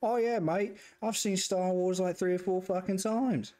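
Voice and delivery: high-pitched, hammed-up Aussie; horrible Australian accent. Man imitating a Star Wars fan abroad, bad Australian accent. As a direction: do a badly faked Australian fan. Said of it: "That was probably a horrible Australian accent".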